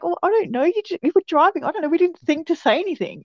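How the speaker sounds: high-pitched, defensive mock protest; retold flustered excuse. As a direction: act out scrambling to explain yourself. Retelling flustered excuses to annoyed mum.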